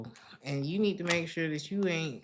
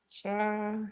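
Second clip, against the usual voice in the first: usual speaking voice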